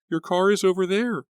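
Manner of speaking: put-on high, urgent girl voice; frightened young woman. A narrator's reading. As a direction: point it out in panic.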